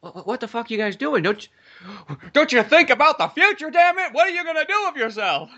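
mock outraged scolding, pitch climbing high and loud; scolding elder. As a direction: play a fed-up old-timer yelling at lazy kids. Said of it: "take a gander around and go".

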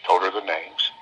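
ordinary delivery

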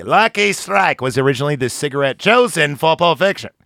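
ordinary talk

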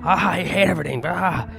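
high, strained yell at moderate volume; hammed-up scream, pitched well up; mock scream. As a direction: act out screaming at a punch bag. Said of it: "I'm screaming. I'm like".

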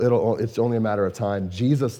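ordinary delivery

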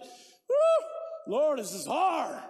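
high, loud woo, then slow, heavy words; overwhelmed cry. Retold or imitated. Pastor reliving hugging the grieving lady, an overwhelmed cry. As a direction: let the emotion burst out then sink. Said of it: "I'm like".

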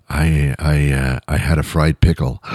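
low, timid little voice; meek sheepish confession. Pretend. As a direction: confess it meekly.

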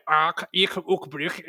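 guttural German-sounding gibberish; throaty fake German syllables; mock German sounds. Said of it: "it's just not my native tongue".

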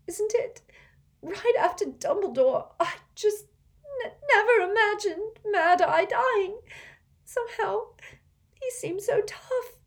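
put-on high, sobbing girl's voice, slowed by tears; a tearful girl breaking down over a death; crying. A narrator's reading. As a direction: choke the words out through tears. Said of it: "She blew her nose and hiccuped".

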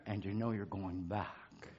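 very soft, low reflection; hushed preaching. Real, not acted. Preacher reflects on God's presence after a hymn, hushed and low. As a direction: drop right down, almost to nothing by the end.

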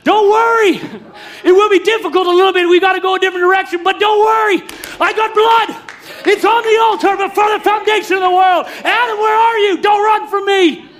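very high, loud, urgent reassurance; father calling out. Acted. Preacher voicing God calling Adam not to run, loud. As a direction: shout comfort after a runaway son. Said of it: "If you could call it".

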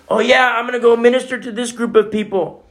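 naive eager convert. Retold or imitated. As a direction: toss it off with naive confidence.

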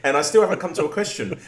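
loud laughter spilling through the words; laughing. Real, not acted. Host cracks up over his own unfinished question, laughing loudly. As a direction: talk while cracking up at yourself.

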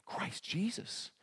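whispered, slow and reverent; awed whisper. Shown as a demonstration. Preacher whispers the name to make it jump off the page. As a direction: breathe the name in awe. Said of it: "it ought to jump off the page".

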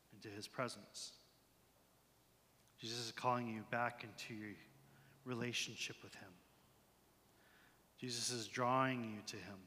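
low, soft, pausing pastoral voice; gentle ministry. Real, not acted. Pastor telling worshippers they are being drawn back, soft and low. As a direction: softly speak into a hushed room with long pauses.

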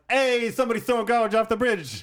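high, hammy New Yorker call; New York bystander. Retold or imitated. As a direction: shout it like a local. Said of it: "They're just like".

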